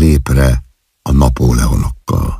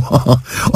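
breathy giggle; 8 semitones higher, 4 dB quieter than usual. Acted. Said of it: "úgy kaszagott".

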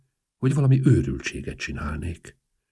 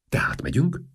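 suttogás; 9 semitones higher than usual. A narrator's reading. Izgatott kérdés.

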